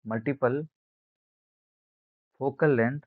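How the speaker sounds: term split by a long pause; spaced out for note-taking; slow dictation. Shown as a demonstration. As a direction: dictate it chunk by chunk.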